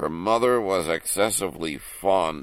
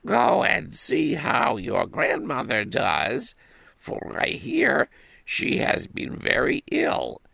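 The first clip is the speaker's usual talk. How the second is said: mother voice; 11 semitones higher than usual. Acted. Mother sends girl to grandmother.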